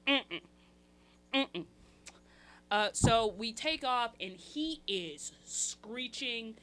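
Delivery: normal talking voice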